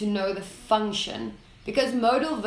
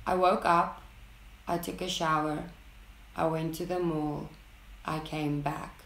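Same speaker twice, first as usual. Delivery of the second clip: flat, even, low routine delivery; monotone level intonation; 5 semitones lower, 6 dB quieter than usual. Shown as a demonstration. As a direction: read it like a dull daily list. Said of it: "Level intonation is used in routine situations".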